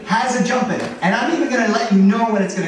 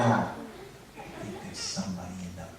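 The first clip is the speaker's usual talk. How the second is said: soft, hushed spooky build-up; creepy hush; 11 semitones lower, 14 dB quieter than usual. Retold or imitated. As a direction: drop your voice before the scare. Uncle builds suspense before scare.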